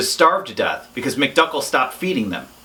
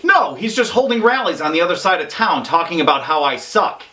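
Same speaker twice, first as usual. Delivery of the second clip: ordinary talk, nothing marked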